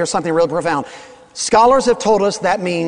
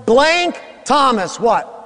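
calling out; 7 semitones higher, slower than usual. Acted. Calling on congregation for answer.

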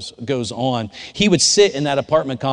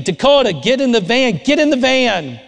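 high, loud urgent yell of a name and order; retold desperate scream; 12 semitones higher, 6 dB louder than usual. Pastor screaming at a boy about to walk away, high and loud. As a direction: yell for the boy to get into the van. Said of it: "I'm looking at her and I'm screaming".

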